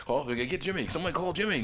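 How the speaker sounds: high, urgent call for help; panicked manager calling. Retold or imitated. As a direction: shout for the medic in alarm. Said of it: "the medical guy's name is".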